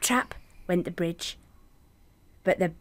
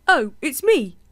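put-on higher, louder young goat; goat's bigger voice; 6 semitones higher, 5 dB louder than usual. Second goat answering the troll, higher and louder. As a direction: answer up bright and loud. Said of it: "who didn't have such a small voice".

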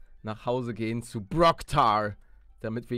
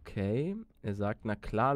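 ordinary talk

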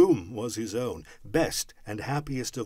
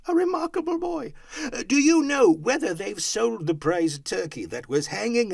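high, eager praise and questioning; gush, then call the question down; excited raised voice; delighted Scrooge; 11 semitones higher, 6 dB louder than usual. A narrator's reading. Scrooge delighted, calling to boy.

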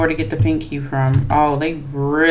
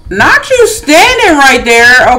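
startled; 8 semitones higher, 7 dB louder, slower than usual. Real, not acted. Startled by killer nearby.

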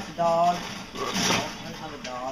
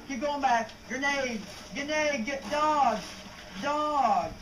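high-pitched alarmed calls; urgent callouts; 12 semitones higher, slower than usual. Real, not acted. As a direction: cry out each threat to a teammate. Calling out threats to teammate.

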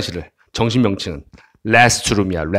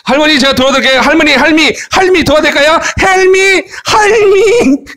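high, hammed-up offer of help, growing to a long cry; eager calling out; 11 semitones higher than usual. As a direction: call out to granny, higher and higher. Acted calling out to a grandma.

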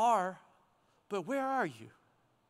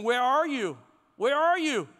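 raised, louder searching call; calling for attention; 3 semitones higher, 6 dB louder than usual. Acted. God calling to get our attention, calling out. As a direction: call it out twice, pitched up. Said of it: "God is trying to get our attention".

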